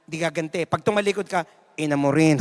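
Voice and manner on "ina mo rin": much lower under-the-breath retort; sly muttered comeback. Acted. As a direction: mutter it back once they turn. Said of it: "Sabi niyan".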